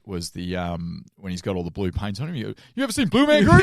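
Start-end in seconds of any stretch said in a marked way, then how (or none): 2.7-3.6 high, loud excited call; hammed-up film quote. Podcaster quoting a favourite comic film moment, hammed-up shout. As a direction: belt it out, hammed up.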